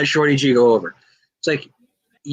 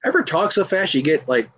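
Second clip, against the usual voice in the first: ordinary talk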